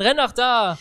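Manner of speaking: high, loud mock yell; imitated shouting parents. Interpreter voicing parents yelling at children, imitated shout. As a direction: holler it like a sideline parent. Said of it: "weil die Eltern schreien".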